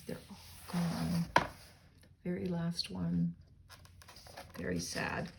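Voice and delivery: soft, slow, sorry aside; quiet sorrow over the lost tree; sad. Real, not acted. Aside after last tree falls.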